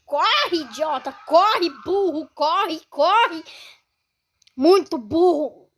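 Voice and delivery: shouting high and loud at the screen; frantic yelling. Real, not acted. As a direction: yell at the character to run. Yelling at game character fleeing. (gritando desesperado)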